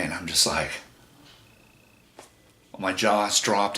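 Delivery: plain reading voice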